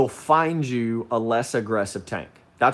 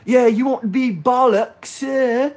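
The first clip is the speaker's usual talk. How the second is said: mock English accent; 11 semitones higher, 5 dB louder than usual. Keeps mocking Aaron's English accent.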